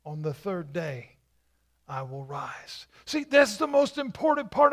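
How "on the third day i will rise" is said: soft, near-whispered Jesus quote; hushed promise. Acted. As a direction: drop to a whisper on the last words. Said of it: "Because Jesus said".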